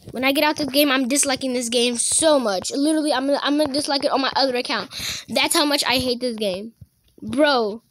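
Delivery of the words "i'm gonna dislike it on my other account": usual speaking voice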